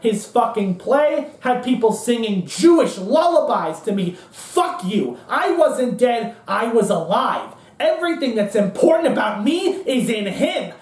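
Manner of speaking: emphatic, heated tirade, stretching key words; Cohn. Acted. Cohn claiming the boy as his son, defiant tirade. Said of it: "And Cohn says".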